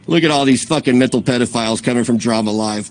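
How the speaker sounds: usual speaking voice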